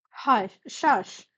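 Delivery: lightly snapped hush; gentle snap. A narrator's reading. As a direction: hush them with a mild snap. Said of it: "Gladion snapped gently".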